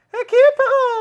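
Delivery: very high, loud laughing squeak; chipmunk voice. Pretend. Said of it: "sound like a chipmunk".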